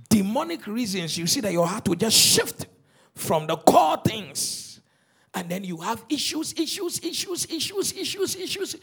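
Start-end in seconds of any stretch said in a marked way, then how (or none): 6.1-8.7 high-pitched, repetitive chant of issues; mock fretting. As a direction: pile them up in a high voice. Preacher mocks piling-up problems.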